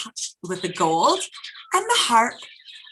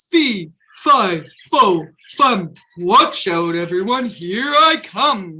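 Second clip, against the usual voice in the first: giant's roar; 3 semitones lower, 6 dB louder than usual. A narrator's reading. Storyteller roaring the giant's chant at the children. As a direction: roar it out like a big hungry giant. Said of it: "roared the giant".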